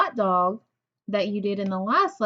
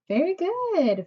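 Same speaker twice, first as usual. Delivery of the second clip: bright praise; 8 semitones higher, slower than usual. Real, not acted. Teacher praising class.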